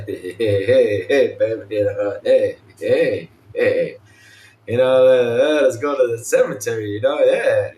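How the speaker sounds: low, lazy come-on voice; smooth flirty guy. Retold or imitated. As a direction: croon it like a cheesy ladies' man. Mocking the game's hero flirting.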